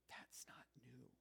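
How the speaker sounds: unvoiced whispered aside; mock whisper. The disciple whispering his doubt to the congregation. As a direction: breathe it like a skeptical secret.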